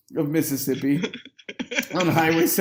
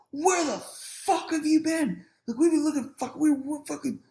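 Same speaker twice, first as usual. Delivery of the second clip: high, frantic demand to know; mock exasperated roommates; 10 semitones higher than usual. Roommates confronting him as he walks in, high exasperated voice. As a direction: imitate worked-up friends in a high pitch.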